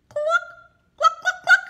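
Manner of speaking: very high, loud hen clucks; hen clucking. Acted. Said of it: "what does a hen say?".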